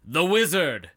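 lightly imitated dramatic sample; imitating song's intro voice. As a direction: announce it like the track's opening voice. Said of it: "a voice that just says".

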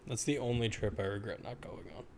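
ordinary delivery

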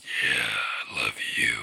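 deep growling demon voice; demonic voice. Acted. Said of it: "growl whispered in your ear".

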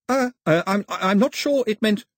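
bluffing his way through the song; flustered Pilu. Acted. Pilu hesitant.

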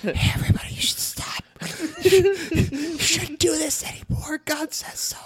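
high whispered kindly God voice; God's voice. Pretend. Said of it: "Is that God's voice?".

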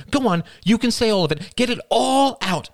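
high, loud furious tirade; furious mother. Acted. Mother berating her son, high and furious. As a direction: let him have it all.

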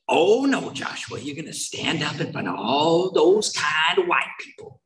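drawn-out impression of the sponsor, starting high; slow, exaggerated mock warning as the sponsor; imitating his sponsor. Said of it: "That's how he talked, right?".

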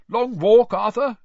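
high, loud hearty question; hearty greeting. A narrator's reading. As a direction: hail an old friend boisterously. Cedric's father hails his friend.

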